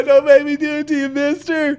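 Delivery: high loud frantic pleading; panicked. Retold or imitated. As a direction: shriek like you're terrified. Panicked imagined standoff.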